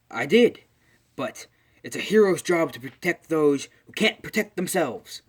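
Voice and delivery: high, loud, emphatic hero speech; acted heroic declaration. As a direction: proclaim a hero's duty, play-acting. All Might proclaims hero's duty.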